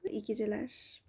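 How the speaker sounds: soft whispered goodnight. Real, not acted. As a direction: whisper it gently.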